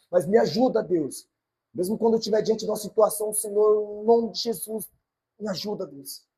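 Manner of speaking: rising, loud pleading prayer to God; preacher praying aloud; fervent prayer. Acted. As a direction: pray it out with urgency.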